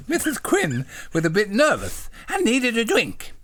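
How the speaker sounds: high, simple-minded little voice; eager, high-pitched polite question; childlike John. Acted. Voicing John in the story.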